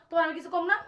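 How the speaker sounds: normal talking voice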